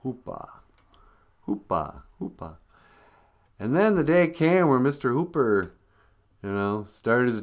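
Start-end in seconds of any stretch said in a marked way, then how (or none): 0.0-2.6 "Hoopa" trailing off softer, R dropped; New York accent. Shown as a demonstration. Mimicking the shopkeeper's New York accent, "Hoopa" without the R. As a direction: repeat "Hoopa" with an open New York ending. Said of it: "Eastern accent in New York".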